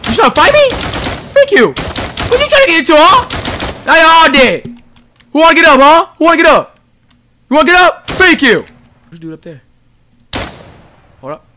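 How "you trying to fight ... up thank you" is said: high, loud cocky trash talk; taunting yell. Real, not acted. Gamer trash-talking the enemies he shot, cocky yell. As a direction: dare them to get up.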